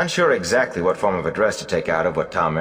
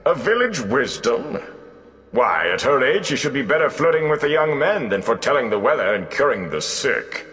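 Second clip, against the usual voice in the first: lightly higher, amused bard voice; mocking old bard; 8 semitones higher than usual. Gleeman scoffs at the young wisdom, amused. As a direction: scoff at her like a showy minstrel.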